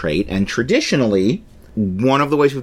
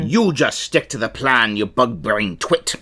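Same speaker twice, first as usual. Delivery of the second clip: scolding pirate, slowing on the insult. Acted. Pirate scolding a foolish crewman, pirate voice. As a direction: put a crewman in his place, pirate-style. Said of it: "I'm gonna do a pirate voice".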